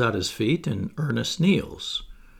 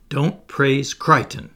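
louder, slow, pointed rebuke; quick rebuke; 4 semitones higher, 7 dB louder, slower than usual. Acted. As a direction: cut off any praise of Crichton.

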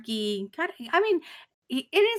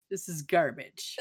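low, soft, slow and dismissive; deadpan disdain; 6 semitones lower, 4 dB quieter than usual. Retold or imitated. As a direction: dismiss it flatly like Spock. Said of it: "this photography sucks".

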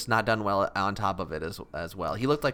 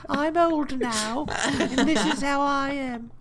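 put-on high, slow old voice; very old faint voice; 3 dB louder, much slower than usual. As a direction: quaver it out like a frail elder. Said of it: "When I'm older".

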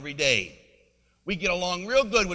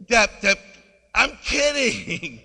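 loud, high comic outburst; exasperated protest; 8 semitones higher, 9 dB louder, slower than usual. Retold or imitated. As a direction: stretch it out in mock-exasperated disbelief. Retold exasperated protest to student.

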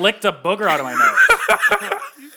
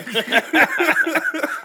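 laughing; 10 semitones higher than usual. Real, not acted. Apologizing to listeners, laughing.

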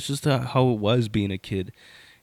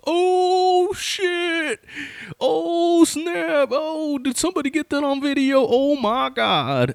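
very high, loud, drawn-out mock howl; imitated jeering onlooker; 3 dB louder, slower than usual. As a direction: hoot in high-pitched disbelief like a jeering bystander.

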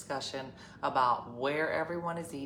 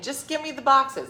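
higher, louder snapping demand; mock demand; 8 semitones higher, 9 dB louder than usual. Daughter demanding the boxes from her sister, loud. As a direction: grab for the boxes with your voice.

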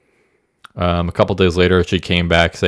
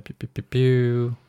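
gunshot noises; 6 semitones higher, 5 dB quieter, slower than usual. Pretend.

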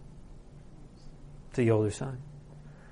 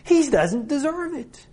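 high, raised-voice indignation; furious, disbelieving protest to a father; outraged older son; 7 semitones higher than usual. Acted. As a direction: spit out the accusation, climbing in pitch. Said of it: "that's what he's doing here".

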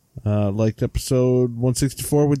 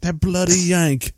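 higher-pitched mock Brit; mock English accent; 7 semitones higher than usual. Voicing the offended UK listener, mock English accent. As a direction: put on an indignant English accent.

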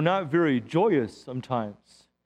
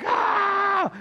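high exasperated yelled Ah!; frustrated yell; 14 semitones higher than usual. Retold or imitated. Pastor acting out shoveling rage for the congregation, high yell. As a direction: let out a high exasperated scream. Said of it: "And I'm yelling and screaming".

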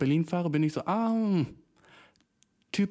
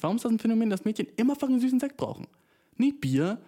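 exasperated rant; 9 semitones higher, faster than usual. Real, not acted. Ranting about sweet wine.

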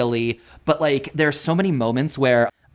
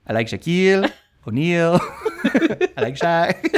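weird voice; 3 semitones higher than usual. Retold or imitated. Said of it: "in the weirdest voice and in the weirdest delivery".

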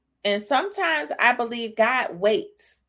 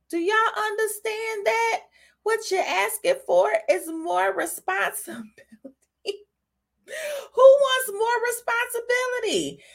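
rising preaching; 11 semitones higher than usual. Real, not acted. Preacher rising in pitch, laughing.

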